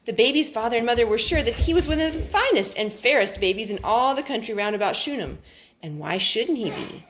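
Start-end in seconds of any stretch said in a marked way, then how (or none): none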